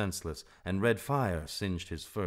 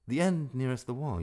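higher spoken repeat, not sung; businesslike repetition, pitch raised; checking back; 7 semitones higher than usual. A narrator's reading. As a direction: echo the directions to confirm.

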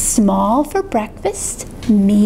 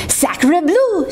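put-on higher French-accented exclamation; duck's French cry; 5 semitones higher than usual. Duck spots the nest, French-accented cry. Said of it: "she cried".

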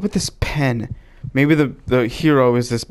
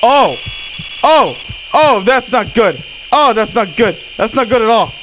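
tag; 8 semitones higher than usual. Pretend. Panicked surgeon reassuring game patient.

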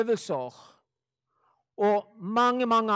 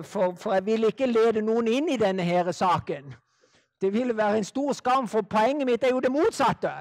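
ordinary talk, nothing marked

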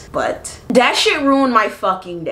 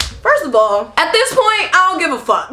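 raised, rising outburst; heated retort; 8 semitones higher than usual. Retold or imitated. Fed up, snapping back at the caller. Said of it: "That's what I said".

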